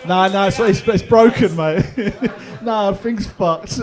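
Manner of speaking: high, loud laughing mock excuse. Comic mocks the man claiming the gripper is broken, laughing. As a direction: lampoon his excuse while laughing.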